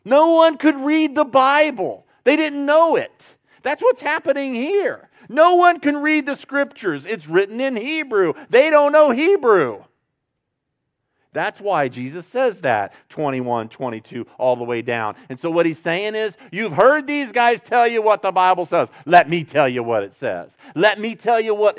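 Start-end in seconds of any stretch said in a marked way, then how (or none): 0.1-9.8 high, loud, fervent preaching. Acted. Preacher to the church, higher and louder, pressing that nobody could read the Bible. 16.5-21.8 brash tone. Retold or imitated. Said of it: "he doesn't say it with that tone. That's my tone".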